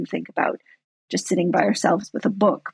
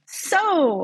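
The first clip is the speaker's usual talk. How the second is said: a high, bright attention-grabbing So,; attention call; 9 semitones higher than usual. Shown as a demonstration. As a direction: call So, to hush a rowdy crowd. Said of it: "So it's like saying".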